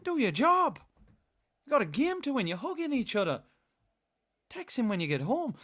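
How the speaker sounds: high mock Irish accent, scolding; exasperated Irish pundit impression; Roy Keane. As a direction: tell players off in a put-on Irish voice. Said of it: "he thought I was Roy Keane then".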